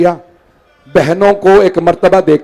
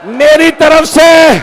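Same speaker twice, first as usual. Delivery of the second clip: high, slow, rising mourning shout; keening recitation of the mother's will; wailing lament; 8 semitones higher, much slower than usual. Acted.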